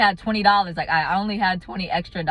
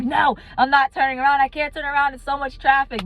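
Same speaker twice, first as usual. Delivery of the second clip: much higher, whiny and fed up; frustrated protest; 8 semitones higher than usual. Retold or imitated. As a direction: refuse him, at the end of your rope. Refusing friend's advice, stressed.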